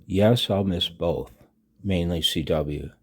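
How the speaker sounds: usual speaking voice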